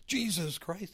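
lightly voiced excited gentleman; excited retelling. Narrator imitates old man hearing Jesus Christ, mock excited. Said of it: "he's all excited".

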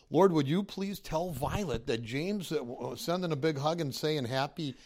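lightly replayed earnest prayer; retold prayer. Narrator replays praying for the widower, mild prayer voice.

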